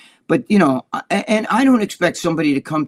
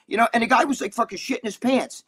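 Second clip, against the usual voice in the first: usual speaking voice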